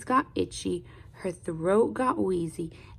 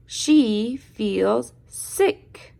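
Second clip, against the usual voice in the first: slow answer; 6 dB louder, much slower than usual. Shown as a demonstration. Reader models answer to children.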